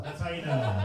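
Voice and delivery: ordinary talk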